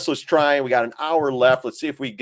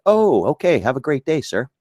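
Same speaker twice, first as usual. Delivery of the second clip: higher, mock-deferential cop voice; suddenly polite cop; 6 semitones higher than usual. Cop shown the card backs off, mock politeness. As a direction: lightly imitate a cop turning courteous. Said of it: "they're like".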